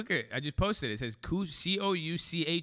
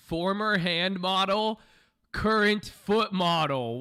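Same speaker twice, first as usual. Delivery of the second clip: mock boast; 5 semitones higher, 7 dB louder, much slower than usual.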